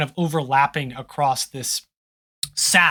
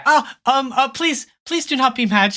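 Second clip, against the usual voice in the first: panicked; 7 semitones higher than usual. Acted. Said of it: "she looks a little panicked".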